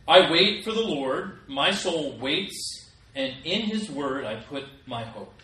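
solemn, measured reading of the psalm; scripture reading. Acted. Reads Psalm verses aloud.

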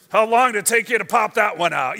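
quick, blunt Brooklyn guy; Brooklyn accent. Acted. Shepherd bluntly asking Mary about the birth, Brooklyn accent. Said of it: "why they have Brooklyn accent".

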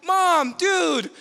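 mock outrage at mom; half-joking yell at mom over the trash can; exasperated yell at mom. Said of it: "I'm like".

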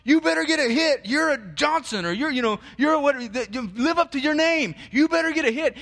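high, loud yell from the stands; yelling dad. Retold or imitated. Pushy father yelling at his son at bat, loud and high. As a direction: bark it like a pushy dad in the bleachers. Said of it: "his dad who's in the stands yelling at him".

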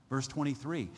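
ordinary talk

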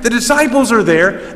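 ordinary talk, nothing marked

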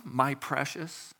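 lightly done Gollum, drawing out the words; a hint of Gollum cooing over the ring. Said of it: "Recognize that one?".

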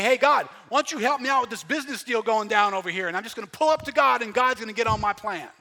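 loud, high, breezy mock of a casual churchgoer; mocking a casual believer. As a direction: lampoon the guy who drives up to God. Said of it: "a world today that thinks".

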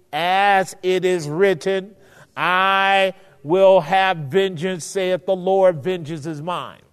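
slow, loud, solemn quotation; scripture proclamation. Acted. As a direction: declare it like reading from the pulpit. Quoting scripture with solemn force.

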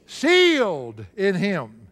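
Sealed stretched out, in him weighted; slow emphatic completion. Shown as a demonstration. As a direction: draw out Sealed for the congregation.

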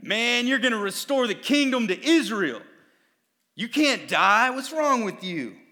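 a hint of fiery Zealot; outraged demand; indignant Zealot. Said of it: "he's like".

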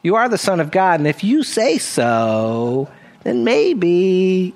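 hemming and hawing, words stretched out; rationalizing. Pretend. Preacher imagining himself accepting the compliment, mock rationalizing. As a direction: talk yourself into it, dragging the last words. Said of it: "think about it and rationalize and say".

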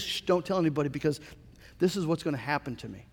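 quick, low, soft aside after a shush; hushed secrecy. Acted. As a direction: hush them and confide it quickly. Acting Jesus hushing the disciples.

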